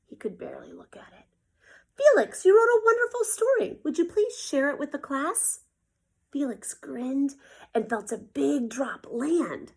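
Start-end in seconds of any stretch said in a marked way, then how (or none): 2.0-5.6 higher, louder praising voice; teacher praising Felix's story, higher; kind teacher. Acted. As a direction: praise him warmly.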